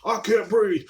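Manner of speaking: high, loud mock complaint of "I can't breathe"; mock whiny customer. Mocking a masked customer complaining to him, high mock whine. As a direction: whine it like the pissed-off customer in a mask.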